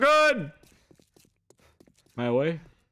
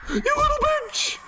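high laughing insult; shrieking laugh. Real, not acted. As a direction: laugh while cursing. Laughing at enemy.

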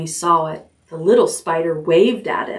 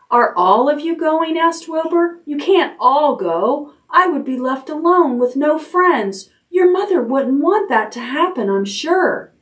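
1 pleading; 8 semitones higher than usual. A narrator's reading. Wilbur pleads with departing spiders.

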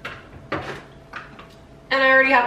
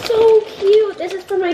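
high, loud gushing; delighted squeal; 9 semitones higher, 5 dB louder than usual. Real, not acted. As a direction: gush over the gift. Opening gifts.

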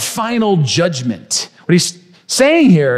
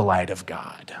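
whisper; 12 dB quieter than usual. Real, not acted. Sermon's key point, hushed.

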